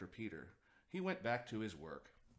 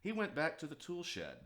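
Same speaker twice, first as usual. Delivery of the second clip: ordinary delivery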